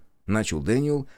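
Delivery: usual speaking voice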